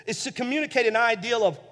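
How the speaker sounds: ordinary delivery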